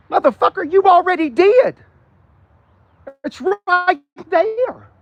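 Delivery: high exasperated retort. Real, not acted. Retort to CEO claim.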